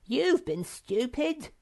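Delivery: high, slow, squeaky put-down; smug whiny correction; accusing Sniff. A narrator's reading. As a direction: point the finger. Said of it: "said Sniff".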